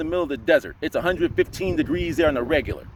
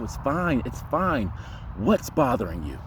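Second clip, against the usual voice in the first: soft, lowered soothing voice; gentle reassurance; 4 semitones lower, 5 dB quieter, slower than usual. Retold or imitated. Driver calms his apologising passenger, soft and reassuring. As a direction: ease off, low and quiet, to comfort.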